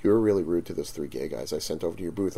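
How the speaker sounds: low, soft, quick retold reproach; friend's reproach. Friend coming over to the booth, quietly reproachful. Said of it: "my friend comes walking over. She goes".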